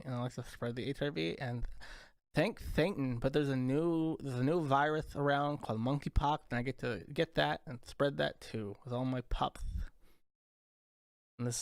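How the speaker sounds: ordinary talk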